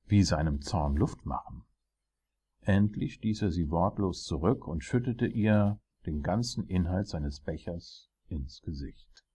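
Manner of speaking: ordinary delivery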